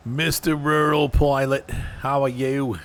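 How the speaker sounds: slow, drawn-out attempt at a New Zealand accent; bad New Zealand accent. Streamer greeting Mister Rural Pilot in a bad New Zealand accent. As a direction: ham up a Kiwi accent that slides toward Australian. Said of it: "That was not a good New Zealand accent".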